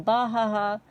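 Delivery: spoken-out mock laugh; narrated laughter. A narrator's reading. Narrator retells laughing from the tickles, mock laugh. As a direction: read the laugh out as words. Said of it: "I started laughing".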